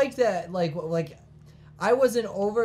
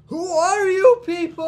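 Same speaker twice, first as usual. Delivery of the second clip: mock outraged; 10 semitones higher, 6 dB louder, slower than usual. Mock outraged slow shout.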